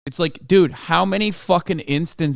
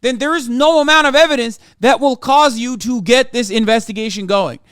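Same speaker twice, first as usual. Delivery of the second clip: raised, heated rant, higher and louder; angry rant; 7 semitones higher, 5 dB louder than usual. Real, not acted. Streamer ranting at UK police, angry and raised. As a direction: let the outrage build and spill over.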